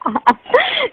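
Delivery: a sudden high-pitched burst of laughter. Real, not acted. Girl laughing at her friend's crude joke on the phone, high burst. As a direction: laugh up high, caught off guard.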